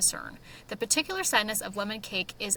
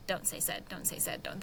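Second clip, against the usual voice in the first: softer, fading into a whisper; inner reminder; 3 semitones lower, 10 dB quieter than usual. Retold or imitated. Writer silently drilling herself to avoid said, in a soft whisper. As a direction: tell yourself the rule under your breath. Voicing her own writing rule.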